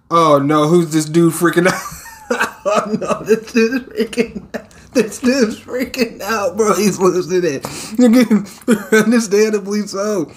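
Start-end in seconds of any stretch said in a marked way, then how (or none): none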